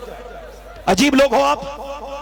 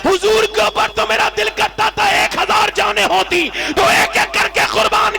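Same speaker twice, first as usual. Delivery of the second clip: fervent shout; 9 semitones higher than usual. Acted. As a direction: shout it out as the fearless companion. Companion answers the king defiantly.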